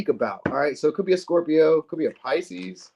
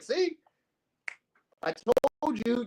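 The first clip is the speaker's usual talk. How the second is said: high, soft teasing gloat; smug told-you-so; 10 semitones higher, 5 dB quieter than usual. Retold or imitated. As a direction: rub it in, playfully.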